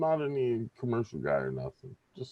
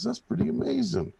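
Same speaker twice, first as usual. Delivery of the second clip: much higher, impressed bartender voice; amazed bartender; 13 semitones higher than usual. Acted. Bartender marveling at the chimp, higher voice. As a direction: pitch it way up in wonder.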